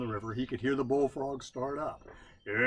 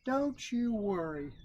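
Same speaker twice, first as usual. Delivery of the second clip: reassuring; 8 semitones higher, slower than usual. A narrator's reading. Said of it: "said Brother Rabbit".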